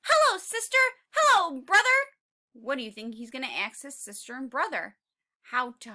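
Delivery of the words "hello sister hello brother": Tiny's voice. Acted. Tiny greeting siblings, high.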